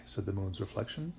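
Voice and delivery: plain reading voice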